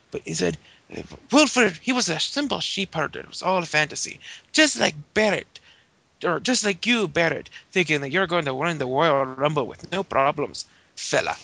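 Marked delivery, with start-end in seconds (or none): 1.3-11.4 a badly faked Irish lilt; bad Irish accent. Retelling Sheamus taunting Barrett in a bad Irish accent. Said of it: "This is not a very good Irish accent".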